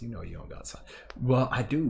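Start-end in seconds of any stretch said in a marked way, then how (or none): 0.0-0.8 a secret note to self, breathless whisper; whispered aside. Real, not acted. Reminding herself she has no time, whispered. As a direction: whisper it to yourself.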